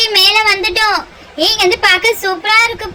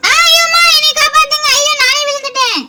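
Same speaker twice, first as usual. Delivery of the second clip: scared cry; 5 semitones higher than usual. Acted. Girl slipping on the hill cries out in fright. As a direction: yelp in fright, calling for help.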